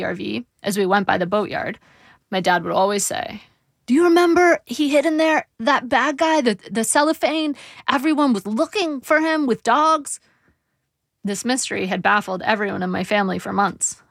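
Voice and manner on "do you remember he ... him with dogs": put-on higher, muddled old man's voice; dad's voice. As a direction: voice the confused father. Dad voiced with his recurring line.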